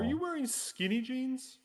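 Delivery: teasing. Real, not acted. Teasing about pants.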